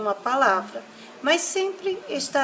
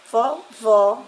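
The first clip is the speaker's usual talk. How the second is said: "vovó" with the final "vó" punched louder; stress on last syllable; 6 dB louder than usual. Shown as a demonstration. Teacher showing children an oxytone, final stress. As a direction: lean hard on the end of "vovó". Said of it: "se encontram na última sílaba".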